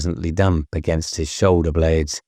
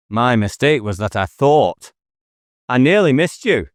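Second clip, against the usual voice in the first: higher-pitched detective calling into the woods; a raised, needling call to a man in hiding; taunting challenge; 7 semitones higher than usual. Acted. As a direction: goad the hidden killer into the open. David taunts the hidden killer.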